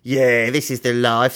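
agreeing butcher's put-on voice; lightly voiced accomplice. Billy agreeing with Mister Gum, in sidekick voice. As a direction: echo it as the rogue's sidekick. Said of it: "said Billy".

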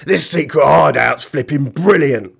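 loud, rough-spoken character yell; gleeful villain shout. Acted. Mister Gum delighted with his secret hideout, shouting. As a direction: shout it out with relish. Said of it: "shouted Mister Gum".

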